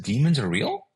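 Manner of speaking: taken aback, pitch lifting; incredulous question. Retold or imitated. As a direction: retell it as a startled question. Startled question on hearing of demons.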